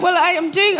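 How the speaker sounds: ordinary delivery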